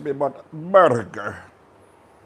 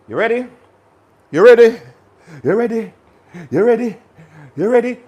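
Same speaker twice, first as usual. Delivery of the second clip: hype; 6 semitones higher, 6 dB louder, faster than usual. Acted. Hyping viewers before eating.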